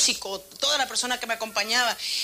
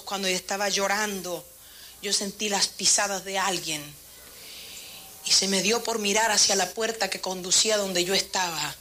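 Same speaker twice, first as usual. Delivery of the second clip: usual speaking voice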